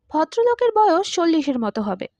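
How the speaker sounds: usual speaking voice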